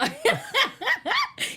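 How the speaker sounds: bursts into a high laugh. Real, not acted.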